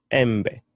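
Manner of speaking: "embe" said em-beh, short e sounds; Swahili e vowel. Shown as a demonstration. Teacher modelling the Swahili word for mango. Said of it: "which is a mango".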